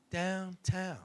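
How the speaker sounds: very soft, low "downtown", drawn out; whisper-soft "downtown" with a wink in it; winking innuendo. Retold or imitated. Woman winking as she hints "downtown", hushed and sly. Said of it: "And she winked".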